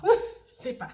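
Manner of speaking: high, loud laugh into the words. Real, not acted. Young mother laughing off where to baptise her daughter. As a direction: laugh it off, helpless.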